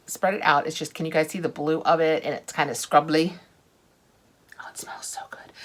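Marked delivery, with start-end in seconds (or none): 4.6-5.5 breathy whisper of delight. Real, not acted. Vlogger smells the scrub, whispering in delight. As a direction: whisper it like a treat.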